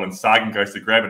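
ordinary talk

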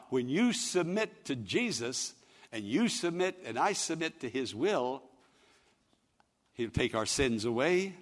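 ordinary talk, nothing marked

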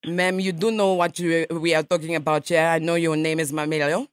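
a light mock Russian accent, nasal ma'am; radio prank caller in a lightly faked Russian accent; put-on Russian accent. Prankster calls a stranger as a Russian journalist, mock Russian accent. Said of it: "I speak Russian".

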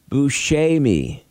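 "Buscemi" as boo-SHAY-mee; Italian pronunciation. Shown as a demonstration. Host explaining the proper name, Italian pronunciation. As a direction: give "Buscemi" an AY in the middle. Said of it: "the E is pronounced as an A in Italy".

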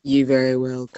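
low, menacing villain; scary bad guy voice. Guest judge trying the line over for laughs, scary bad guy voice. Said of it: "too scary. I found it scary".

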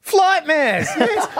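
high, excited cry of recognition; thrilled high exclamation; delighted. Real, not acted. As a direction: squeal with joy at the name. Excited about segment name.

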